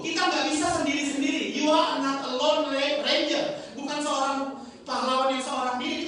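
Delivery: high, loud, forceful sermon delivery; preaching. Acted. Pastor urging congregation they need one another, forceful preaching. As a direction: drive the point home at full force.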